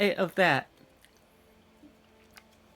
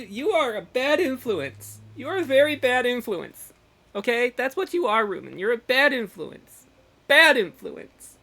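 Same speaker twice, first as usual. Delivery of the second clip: emphatic, higher-pitched telling-off; mock scolding; 4 semitones higher, 6 dB louder than usual.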